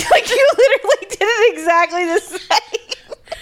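high, laughing through the words. Real, not acted. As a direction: still giggling. Still laughing at the clip.

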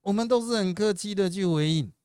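high-pitched, put-on courteous voice; very polite. Shown as a demonstration. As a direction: respond with showy courtesy to a voter.

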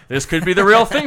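loud, high auction patter; auctioneer. Shown as a demonstration. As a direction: sell it to the room. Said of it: "old-fashioned auction calling".

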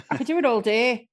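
lightly Irish-accented talk. Shown as a demonstration. Improviser showing off the accent they can do, Irish accent. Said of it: "Give me an Irish accent".

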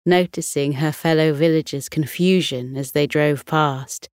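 usual speaking voice

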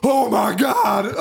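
slow, high mock horror; shocked grown man. Mimicking grown man at The Strangers, high drawn-out mock shock. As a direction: draw it out in exaggerated shock. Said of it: "a guy doing the same thing".

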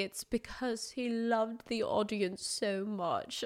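slow, put-on Dutch-ish drawl of the manager; a lightly done imitation of the film manager's accent; mock movie-manager accent. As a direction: parody Tom Hanks's odd accent from the film. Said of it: "I don't know what accent that was".

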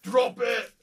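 loud high bark; shouted command. Pretend. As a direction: yell it like an order. Shouted command after vault denied.

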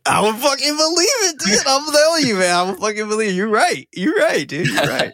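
high-pitched, over-the-top eagerness; excited insistence. Acted. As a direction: gush agreement like an instant true believer. Acts out instantly believing the friend.

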